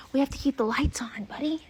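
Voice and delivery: hushed whisper to a foal; apologetic whisper. Real, not acted. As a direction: whisper the bad news gently.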